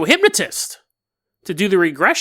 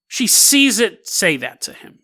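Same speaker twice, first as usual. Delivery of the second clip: usual speaking voice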